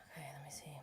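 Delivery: whispered okay, let me see; quiet search aside; whisper to self. Real, not acted. Vlogger hunting through dates, whispered.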